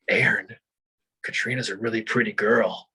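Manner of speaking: low, creepy murmur in the ear; retold whisper. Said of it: "he's just whispering in my ear".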